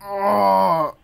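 sharp cry of pain; pained shout. Acted. Hit by a fastball, pained shout. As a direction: yelp as the ball hits.

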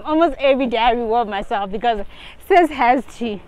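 usual speaking voice

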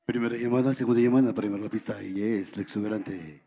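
announcer voice. Pretend. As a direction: announce the dancer like an MC. MC announcing dancer at table.

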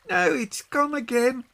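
put-on excited, very high child's cry; Peggy. A narrator's reading. Peggy watching the leaf from the bridge, excited high cry. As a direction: call it out high, watching it float free. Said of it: "cried Peggy".

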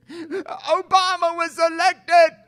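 high, loud, slow panicked wail; panicked cry. Pretend. Scared believer crying out the election news, high and loud. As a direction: shriek the news like the world is ending.

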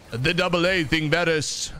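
put-on game champion voice; lightly acted villain quote; Aatrox. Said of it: "Aatrox quotes are just the best".

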